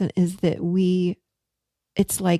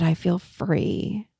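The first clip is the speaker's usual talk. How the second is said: lightly voiced client, words slowed right down; slow, present; much slower than usual. Client finding her truth, quoting her slowly. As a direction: let each word land slowly, calm. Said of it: "she was speaking so slowly".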